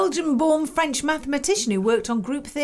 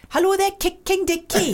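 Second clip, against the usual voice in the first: higher, playful Welsh phone greeting; Welsh accent; 5 semitones higher than usual. Shown as a demonstration. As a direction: answer the phone in put-on Welsh. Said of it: "Try Welsh".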